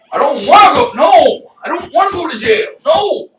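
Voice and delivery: high, loud wailed refusal; panicked protest. Retold or imitated. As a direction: shout it in hammed-up protest. Acted refusal to the cops.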